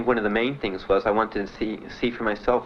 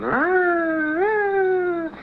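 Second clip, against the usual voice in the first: high, louder wailing siren noise; imitated siren; 5 dB louder than usual. Recruit running round the room as a siren, high loud wail. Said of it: "with your siren".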